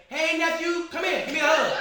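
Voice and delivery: high, loud mock greeting; hollering to nephew. Aunt calls nephew over before staring students, high gleeful holler. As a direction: imitate a relative calling a child over. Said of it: "He was like".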